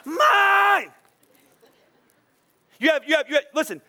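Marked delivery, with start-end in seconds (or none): 0.0-0.9 put-on high, loud toddler yell; a kid grabbing a toy back, shouting high and loud; child shouting mine. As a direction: shriek it like a greedy little kid. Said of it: "what do they say to you from a very young age?".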